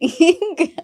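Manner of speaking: short burst of laughter; laughing at her own joke; real laugh. Real, not acted. Comedian laughing at her own church joke, real laugh.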